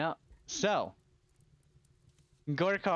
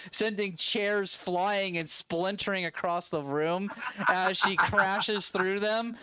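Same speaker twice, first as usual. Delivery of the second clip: ordinary talk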